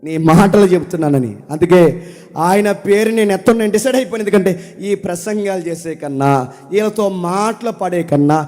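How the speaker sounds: loud, impassioned pulpit preaching; full-force preaching. Acted. Preacher pleading loudly to his congregation, impassioned. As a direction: thunder the prophet's complaint at the congregation.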